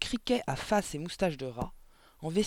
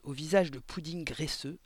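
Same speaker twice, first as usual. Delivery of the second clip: plain reading voice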